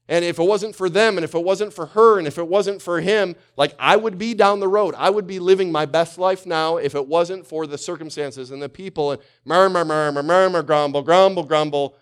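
high, loud griping rant; complaining rant. Retold or imitated. Grumbler ranting about people in his way, loud and high. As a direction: gripe at everyone blocking you.

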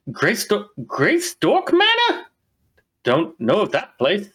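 high, drawn-out dwarf woman, slowing on the name; the innkeeper puzzling over the name, high and slow; dwarf barkeep voice. Acted. Innkeeper stalling the travelers in a high put-on voice. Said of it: "her voice".

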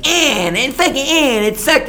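high, petulant mimic of a complaining customer; mock whiny guest. Server mocking a pouting guest who complains, high whiny voice. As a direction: sneer it like a pouting adult.

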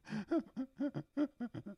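laughing. Real, not acted. Host can't hold it together on air, soft high laughing. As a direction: giggle helplessly up high. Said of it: "I'm laughing again".

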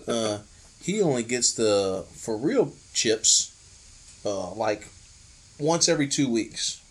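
lightly voiced low conspiratorial tone; Sammy voice. Friend keeps mimicking Sammy's low secretive tip. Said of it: "He said".

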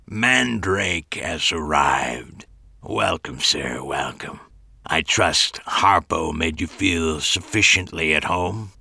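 slow, low throaty growl. A narrator's reading. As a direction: growl it out like a creepy host. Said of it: "his voice a throaty growl".

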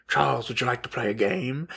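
lightly voiced villain, low and soft; a hint of a menacing invitation; sinister Magneto. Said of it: "when Magneto goes".

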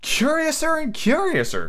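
high, slow amazed cry; cried out. A narrator's reading. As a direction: cry it out in wonder. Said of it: "cried Alice".

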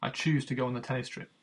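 normal talking voice

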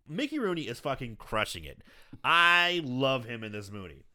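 normal talking voice

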